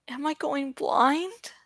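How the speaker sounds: soft, dazed question; quiet wondering. Acted. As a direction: ask yourself in a hush. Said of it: "he wondered".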